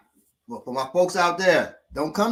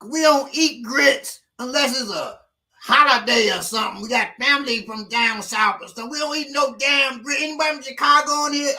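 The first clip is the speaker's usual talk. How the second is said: 1 ranting passenger; 11 semitones higher than usual. Retold or imitated. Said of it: "So he said".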